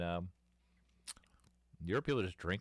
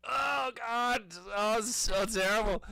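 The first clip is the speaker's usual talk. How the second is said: much higher, hammed-up whine, slower; mock despair; 14 semitones higher, slower than usual. Kids choking down awful beer, hammed-up high whine. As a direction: whimper it like a melodramatic teen. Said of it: "and we're just like".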